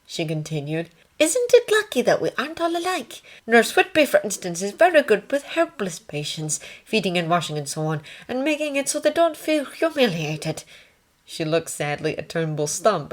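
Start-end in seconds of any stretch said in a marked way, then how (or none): 1.2-10.6 high-pitched nursing sister; ward sister. Acted. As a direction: pitch it well up as a composed sister.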